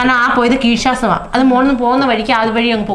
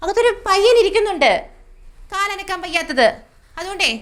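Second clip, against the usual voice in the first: raised scolding; 7 semitones higher than usual. Acted. Hushing the crowd, still pitched up.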